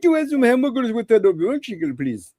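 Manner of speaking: high, hammed-up Middle Eastern accent; mock Middle Eastern accent. Shown as a demonstration. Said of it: "if you're gonna talk like the Habib".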